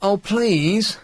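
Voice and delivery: high, pleading whine; wheedling plea. Pretend. Radio host begging colleague for a phone, wheedling. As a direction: beg like a whiny child. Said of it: "Stop whining".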